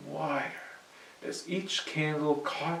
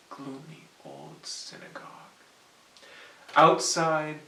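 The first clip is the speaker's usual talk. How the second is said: usual speaking voice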